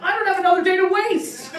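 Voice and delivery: high, loud, laughing exasperation; exasperated yell. Retold or imitated. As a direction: yell it at him, laughing at yourself. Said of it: "I'm like".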